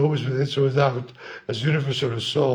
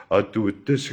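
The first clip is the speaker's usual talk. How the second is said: put-on old voice; 5 semitones lower than usual. Shown as a demonstration. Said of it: "a lot more volume".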